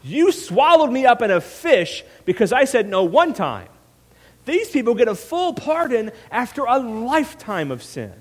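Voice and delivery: horribly sarcastic, loud angry rant; seething sarcasm building into outrage; Jonah. Acted. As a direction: throw it back at God, high and indignant. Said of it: "this horribly sarcastic tone".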